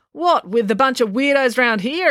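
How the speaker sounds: high-pitched incredulous outburst; exclaiming. A narrator's reading. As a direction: exclaim it in disbelief. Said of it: "exclaimed April".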